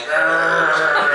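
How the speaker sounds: loud cartoonish growl; imitated angry growl. Girlfriend describing Gaara before treatment, mock growl. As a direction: growl out loud like a troubled character. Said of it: "When he was still all like".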